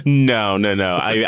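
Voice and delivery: ordinary delivery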